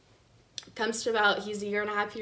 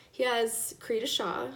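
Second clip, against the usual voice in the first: ordinary delivery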